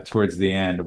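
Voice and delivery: ordinary talk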